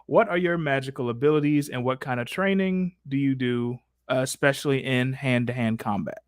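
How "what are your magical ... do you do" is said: ordinary talk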